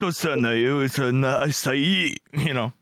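slow put-on anime elder; anime old man. Host riffing on the old man friends picture, anime voice. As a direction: play an old bearded anime master. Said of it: "I picture this old man".

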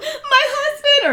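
high, wailing fake sob with laughter; mock crying. Wife calls the police, hammed-up weeping. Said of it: "she calls the cops".

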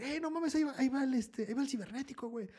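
put-on high, thrilled kid's voice; excited kid spotting. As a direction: squeal it out like a starstruck teen. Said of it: "un morro empezó a decir".

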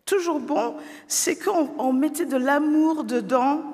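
put-on high, gentle child's voice; shy girl. A narrator's reading. Embarrassed daughter reminds her father, high and gentle. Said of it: "Sophie est un peu gênée".

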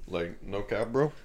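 trying too hard cool. Pretend. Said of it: "Can we be douchebags".

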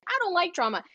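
high-pitched quoted protest; Leslie. Retold or imitated. Quoting a contestant during all this drama, high-pitched protest. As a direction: start high and fall through the line. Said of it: "was Leslie saying".

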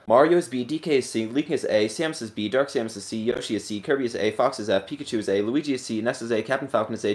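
rattled off in a single breath; all in one breath. Real, not acted. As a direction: race through the list without stopping to breathe. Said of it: "See how far I can get through this list in one breath".

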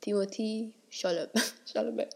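Timothée Chalamet said the French way, with a laugh; French pronunciation. Shown as a demonstration. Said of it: "Como é que se diz em francês?".

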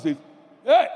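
a loud sharp call from dad; stern dad's call. Retold or imitated. Father calling his son sharply by name, loud. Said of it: "When your dad says".

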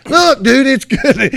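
high and loud, pushing the food on him; loud insisting friend. Retold or imitated. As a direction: sell it hard, high and loud. Kenny insisting it's good.

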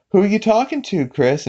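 high-pitched cartoon mom voice; imitated mother. Voicing mother questioning her son.

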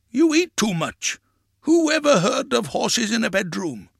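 high-pitched scoffing; scolding. A narrator's reading. Grandpa scoffing at horses in a bedroom, scolding.

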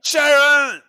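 loud, high mock [name] yell; [name] impression. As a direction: shout the name high and loud, [name] style. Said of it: "I did an [name] accent".

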